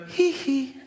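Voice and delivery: high falsetto hee hee. Retold or imitated. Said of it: "And he'd go".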